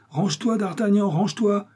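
urgent high-pitched call; shouted warning. Acted. Athos warns his friend from the cellar, crying out. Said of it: "cria Athos".

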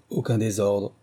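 plain reading voice

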